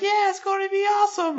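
put-on squeaky high little-kid voice; mock kids cheering. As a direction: pitch way up like excited children.

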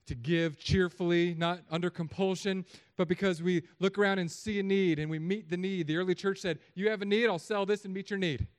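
loud, high pulpit delivery; fired-up preaching. Real, not acted. Preacher rallying church to meet each other's needs, fired up. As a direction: lift the voice and rally the congregation.

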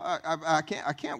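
ordinary delivery

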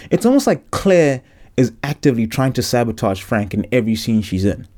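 ordinary delivery

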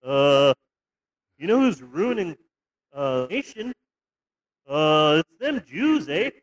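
put-on a light, friendly Canadian accent with a tacked-on eh; lightly voiced cheerful Canadian neighbour; mock Canadian accent. Imitating a polite Canadian Nazi for his co-host, in a light Canadian accent. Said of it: "that Canadian sort of attitude of, like".

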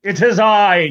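hammed-up pompous Englishman; British accent. Author on the news, in a mock British accent. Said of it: "Now he's British".

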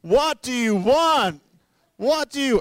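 high, loud, slow romantic-movie plea; Notebook movie shout. Retold or imitated. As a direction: belt it like a lovesick film hero. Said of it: "He's seen the Notebook".

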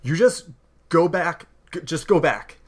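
a frightened house elf pushing the hero back; frightened elf woman. Acted. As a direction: warn the intruder off, voice lifted high. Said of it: "and she goes".

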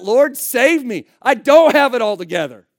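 loud, high plea for rescue; impassioned cry for help. Acted. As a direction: shout it out as a desperate confession. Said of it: "No, he cried out".

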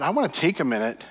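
ordinary delivery

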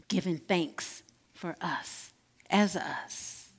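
soft, hushed meditation voice; guided meditation. Acted. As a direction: guide them inward, softly. Leads guided self-love meditation.

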